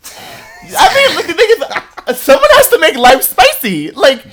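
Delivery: excited laughing. Real, not acted.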